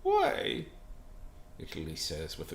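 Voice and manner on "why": very high, hammed-up plaintive why; mock-tearful squeak. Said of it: "Italy tears up and says".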